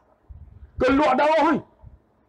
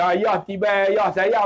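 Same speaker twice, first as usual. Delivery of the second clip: normal talking voice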